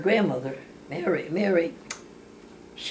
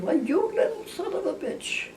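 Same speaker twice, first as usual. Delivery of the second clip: laughing insult; 12 semitones higher than usual. Retold or imitated. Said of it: "I said".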